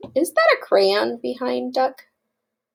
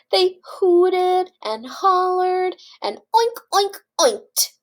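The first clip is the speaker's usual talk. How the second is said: high, slow hollered chant; excited oinking; 8 semitones higher, slower than usual. A narrator's reading. Reader acting out pigs for children, excited oinking. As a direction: hoot it and squeal the oinks.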